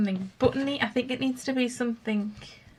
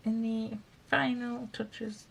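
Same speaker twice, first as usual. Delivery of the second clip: ordinary delivery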